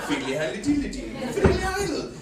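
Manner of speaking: hammed-up Irish lilt singing nonsense syllables; lightly mock an Irish charmer with diddly dee; mock Irish jig. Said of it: "an Irishman walks in the room".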